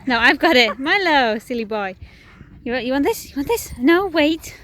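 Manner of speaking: loud, high playful dog commands; talking to a dog. Real, not acted. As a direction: tease the dog with a treat. Playing with the dog.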